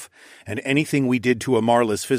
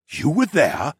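astonished, higher rise-and-fall question; gasping; 4 semitones higher, slower than usual. A narrator's reading. Father stunned by his son's revelation, gasping. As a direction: gasp it in amazement. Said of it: "he gasped".

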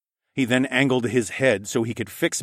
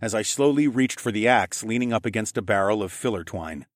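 plain reading voice

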